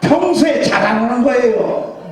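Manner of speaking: normal talking voice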